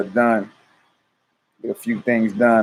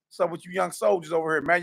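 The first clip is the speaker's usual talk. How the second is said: normal talking voice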